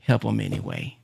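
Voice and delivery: a quiet voice of conscience; soft heart voice. Retold or imitated. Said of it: "But my heart says".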